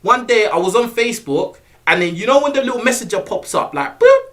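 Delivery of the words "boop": high popping notification blip; boop. Retold or imitated. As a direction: mimic the messenger pop-up sound. Messenger notification sound imitated.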